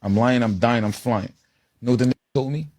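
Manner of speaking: normal talking voice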